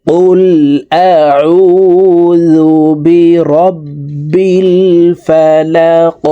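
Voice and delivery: slow, drawn-out chanted recitation; Quranic recitation. Shown as a demonstration. Teacher reciting to students, slow melodic tajweed chant. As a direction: recite it melodically, stretching each vowel.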